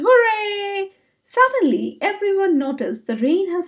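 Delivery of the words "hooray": high, drawn-out storybook hooray; cheering shout. A narrator's reading. Friends seeing Bradley and Ivy return, cheering. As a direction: cheer it out high for the whole crowd. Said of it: "they cheered and clapped and shouted".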